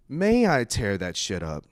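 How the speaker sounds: slow, mock-polite request; well-spoken. Shown as a demonstration. Showing fellas how to approach ladies, mock well-spoken. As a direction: ask it with careful, exaggerated courtesy. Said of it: "Dress and smell nice and speak well".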